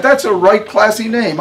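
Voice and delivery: high, loud, laughing delight in the name; delighted praise. A narrator's reading. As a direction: gush over the name with a laugh. Harmony delighted, first time.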